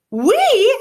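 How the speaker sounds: high, loud startled "We?"; surprised exclaim. Acted. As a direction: blurt it out in amazement. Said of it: "exclaimed the girl".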